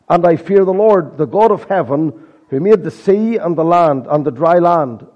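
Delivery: impassioned sermon delivery; preaching. Acted. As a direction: preach it to the congregation. Preaching with rising force.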